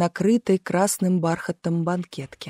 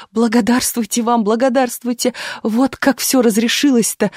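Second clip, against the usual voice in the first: higher, effusive repeated thanks; overcome with gratitude; 4 semitones higher than usual. A narrator's reading. Apple trader thanks the lad, overcome with gratitude. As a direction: pour out thanks with relieved excitement. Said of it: "не в силах сдерживать нахлынувшие на него чувства".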